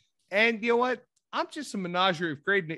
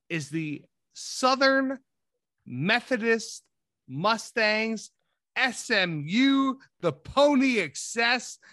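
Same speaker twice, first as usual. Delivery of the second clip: slow, higher, drawn-out announcer reveal; dramatic announcement; 6 semitones higher, 3 dB louder, slower than usual. Real, not acted. Podcaster revealing his draft pick with slow dramatic flair. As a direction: announce each word like a big unveiling.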